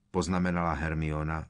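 ordinary delivery

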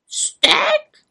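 very high squawked question; raven's echo. A narrator's reading. Raven repeating the witch's word, squawked question. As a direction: squawk it back in surprise. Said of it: "opakoval havran Abraxas".

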